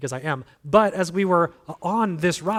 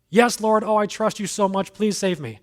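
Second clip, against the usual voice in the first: pleading; 5 semitones higher, 4 dB louder than usual. Retold or imitated. Man pledges trust, begs.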